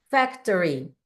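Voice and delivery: "Factory" with a curled English R; som do R inglês. Shown as a demonstration. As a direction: curl the tongue on the R of "Factory". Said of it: "o som do R aqui". ("Factory" com o R enrolado do inglês)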